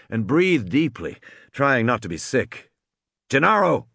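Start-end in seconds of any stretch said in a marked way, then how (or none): 3.3-3.9 sharp call, pitched up; a short, higher-pitched, sharp summons. A narrator's reading. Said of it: "Muldoon's voice was sharp".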